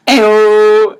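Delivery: high, loud fake-delighted squeal; mock squeal. Teen squeals at the dinner table as his mom outs him, high and loud. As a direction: shriek it with a giant smile, hands thrown up. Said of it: "I put a giant smile on my face, I threw my hands up in the air and went".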